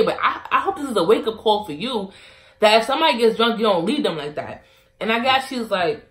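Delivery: usual speaking voice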